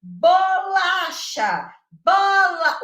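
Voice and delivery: high, loud, slowly drawn out; teacher's syllable demo. Shown as a demonstration. Teacher to a class, loud and drawn out syllable by syllable. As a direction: stretch each syllable for the children. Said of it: "do professor fazer assim".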